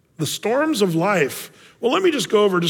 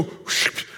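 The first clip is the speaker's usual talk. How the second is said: whoosh; 6 dB quieter than usual. Retold or imitated. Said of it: "the clouds just go".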